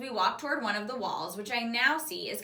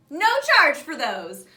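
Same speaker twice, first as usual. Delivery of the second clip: happy shopkeeper; 5 semitones higher, 11 dB louder, slower than usual. A narrator's reading. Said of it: "the lady says happily".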